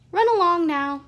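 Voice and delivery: loud, high mock-sweet shooing; girl sending the bully away; bossy dismissal. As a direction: wave the bully off.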